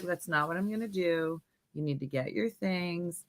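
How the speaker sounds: soft, restrained imitation of a mom; nice mom voice. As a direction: answer back quietly, as a nice mom. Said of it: "the mom was talking in a nice voice".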